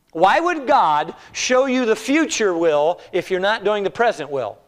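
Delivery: ordinary delivery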